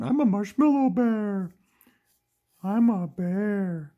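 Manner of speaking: high, loud, drawn-out toy-bear voice; Diva. Pretend. Toy bear says what kind of bear, high funny voice. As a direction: announce it high and stretched, as a silly bear. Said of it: "Diva goes like".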